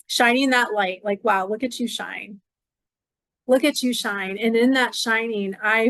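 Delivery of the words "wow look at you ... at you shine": ordinary talk, nothing marked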